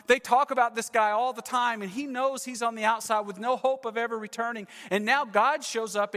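ordinary talk, nothing marked

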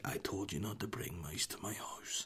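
slow, menacing whisper; menacing guard whisper. Pretend. Mock guard threatening the mouse in a whisper. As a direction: play the sly punk guard, hushed and cruel. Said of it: "be like".

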